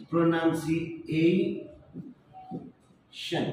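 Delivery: "প্রোনাউন্সিয়েশন" very slowly in parts, pronunsi-ei-shon; right sounds, no accent. Shown as a demonstration. Teacher showing correct pronunciation without accent, drawn out. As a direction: stretch out "প্রোনাউন্সিয়েশন", last syllables set apart. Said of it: "অ্যাকসেন্টটা ঠিক নেই".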